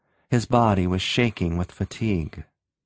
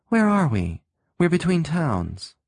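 confused woman; 6 semitones higher than usual. A narrator's reading. Sylvia confused.

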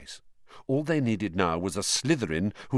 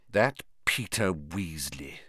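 slow, softer sneer; sneering dismissal; 4 semitones lower, 5 dB quieter, slower than usual. Acted. Malfoy mocks Percy to his cronies, slow and sneering. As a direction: drag out the contempt.

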